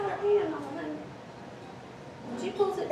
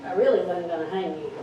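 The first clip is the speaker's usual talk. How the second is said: much lower, laughing motherly warning; low amused mother letting them off with a warning; mother's stern reassurance; 9 semitones lower, faster than usual. Retold or imitated. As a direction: drop the voice low, chuckle, then warn. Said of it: "And she says".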